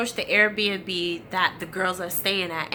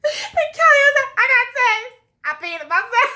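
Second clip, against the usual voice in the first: squealing high through laughter, much louder; laughing; 8 dB louder than usual. Real, not acted. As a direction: quote her while cracking up. Kelly quoted, speaker cracking up.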